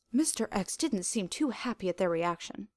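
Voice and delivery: ordinary delivery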